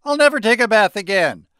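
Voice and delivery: loud, high whine of protest; exasperated groan. A narrator's reading. Boy appalled by holiday magic, exasperated groaning. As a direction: wail it as a dramatic vow. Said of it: "Harry groaned".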